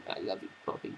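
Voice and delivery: soft whispered I love you; whispered affection. Real, not acted. Owner whispering love to puppy, hushed. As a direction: breathe it softly to the dog. Aside to the dog.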